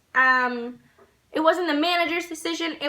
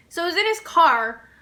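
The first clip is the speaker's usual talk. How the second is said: usual speaking voice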